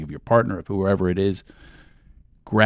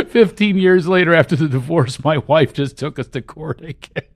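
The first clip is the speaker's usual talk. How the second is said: high, loud, laughing as he lists his woes; laughing through troubles; 8 semitones higher than usual. Shown as a demonstration. Coach demonstrating laughing off his divorce troubles. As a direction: laugh your way through the bad news. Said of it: "You say out loud what's really bothering you".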